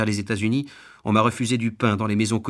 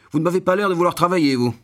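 high, loud, rapid accusation; scornful rebuff; 8 semitones higher, 5 dB louder, faster than usual. A narrator's reading. Gruff householder to a beggar at his door, indignantly. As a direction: throw it out fast and indignant.

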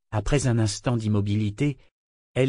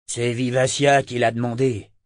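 plain reading voice

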